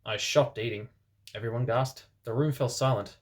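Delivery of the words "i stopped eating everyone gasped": plain reading voice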